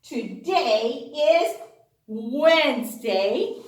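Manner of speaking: ordinary talk, nothing marked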